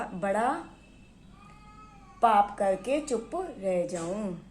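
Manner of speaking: ordinary delivery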